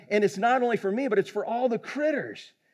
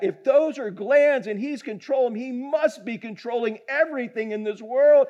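higher, emphatic preaching delivery; fervent repeat; 5 semitones higher than usual. Retold or imitated. Preacher relaying the doctor's conclusion, fervent. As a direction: drive the realisation home with rising conviction. Speaker repeats the quote with fervour.

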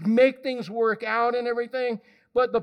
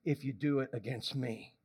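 much lower and softer, calm and firm; gentle voice of God; 8 semitones lower, 7 dB quieter than usual. Pretend. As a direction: soothe like a patient father. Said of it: "And God says".

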